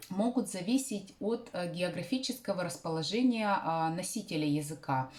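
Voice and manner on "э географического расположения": usual speaking voice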